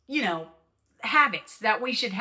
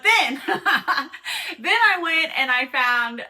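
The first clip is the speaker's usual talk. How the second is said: real laughter breaking into the words, louder; laughing; 4 semitones higher, 5 dB louder, much slower than usual. Real, not acted. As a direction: laugh your way through it, stretching it out. Breaks into laughter mid-story.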